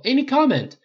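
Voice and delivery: high, loud demand for comment; urgent press holler; clamoring reporter. A narrator's reading. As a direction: shout it over rival reporters. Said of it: "The reporters clamored as one".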